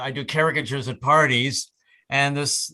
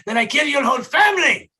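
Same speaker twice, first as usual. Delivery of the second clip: angry Hungarian boss; 10 semitones higher, 5 dB louder than usual. Retold or imitated. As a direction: threaten like an irate Hungarian employer. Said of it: "a guy who was Hungarian".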